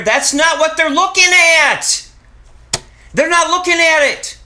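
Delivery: high, loud protest; loud, high-pitched emphatic objection; exasperated shout. Real, not acted. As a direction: shout it with rising insistence. Protests viewers look at wrong thing.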